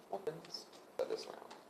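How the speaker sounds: breathless whisper to herself; whispered aside. Real, not acted. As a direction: drop to a whisper, thinking aloud. Reader muttering to herself mid-spread.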